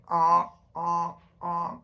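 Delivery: loud blaring aw aw duck honk; big duck call. Retold or imitated. Reading to a child, honking like the big ducks. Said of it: "The big ducks say".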